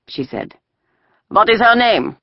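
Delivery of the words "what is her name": soldier voice. A narrator's reading. Soldier questions the girls.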